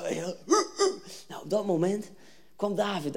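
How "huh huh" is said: high-pitched mock battle grunts; imitated war cry. Armies facing each other, high mock war cry. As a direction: imitate a battle chant in short bursts. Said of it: "dan roepen ze".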